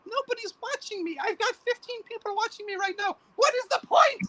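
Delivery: high, strained mock complaint, almost Elmo; whiny YouTuber rant. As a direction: whine high like a neglected creator. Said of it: "He sounded like Elmo for a second".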